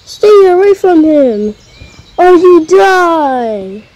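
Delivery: hammed-up mom with a broom; mock yell.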